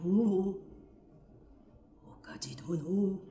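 hushed, half-whispered lovesick plea; passionate lover. Acted. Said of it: "声と動作とは、恋に狂うた男にふさわしい熱情".

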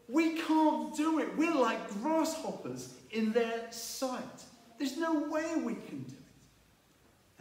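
high, slow, defeated whine of the doubting spies. Retold or imitated. Ten spies moan to Israel, high slow hopeless whine. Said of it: "and ten of them said".

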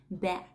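"Back" ending on a crisp unvoiced k; "Back" as /bæk/, k heard; back with final k. Shown as a demonstration. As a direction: remember the k at the end of "Back". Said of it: "nhớ bắt âm k ở cuối".

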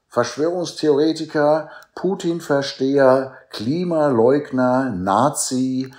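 loud, high, drawn-out list of labels; derisive name-calling. Retold or imitated. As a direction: reel off the slurs with mocking emphasis. Reels off insult labels derisively.

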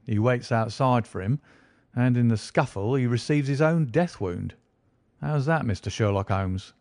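a self-satisfied detective gloating a little; smug inspector. Acted. Lestrade explains his theory.

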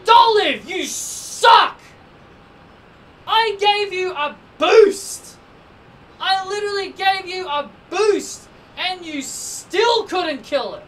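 high, shouted scolding; furious outburst. Real, not acted. Gamer berating Dolive for wasting a boost, furious outburst. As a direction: yell with heavy stress, stretching the key words.

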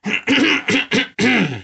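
high pompous throat clear. Acted. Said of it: "He cleared his throat".

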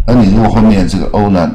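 normal talking voice

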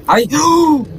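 screaming in terror; shriek, laugh and cry out while caught; being chased. Real, not acted.

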